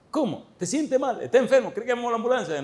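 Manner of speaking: high, worried rapid questions; alarmed dean. Retold or imitated. Worried dean questioning the sleepy professor, high and anxious. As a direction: fuss over him anxiously, high-pitched.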